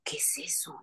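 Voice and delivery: breathy hushed question; soft startled whisper. Retold or imitated. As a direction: whisper it, wary of the noise. Whispered reaction in retold scare.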